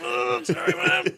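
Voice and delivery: very high whimpering imitation of a child; hammed-up weepy apology; mock tearful apology. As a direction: squeal it like a sorry little kid. Retold apology to crying mom.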